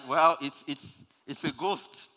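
high, loud stammering fright; mock fright. Disciples seeing Jesus on the water, mock terrified. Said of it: "they were like".

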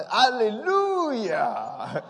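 drawn-out high joyful shout; comic mock shout of praise; shouted hallelujah. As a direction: belt out a long high hallelujah like he wasn't listening. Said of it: "the person shouts".

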